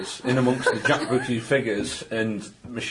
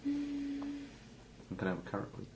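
high, soft, slow silly voice; odd high voice; 13 semitones higher, 16 dB quieter, much slower than usual. Acted. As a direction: coax gently in a squeaky put-on voice. Game master voicing a character.

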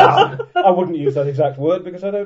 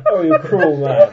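laughing; 4 semitones higher, 7 dB louder, slower than usual. Real, not acted. Laughing reply.

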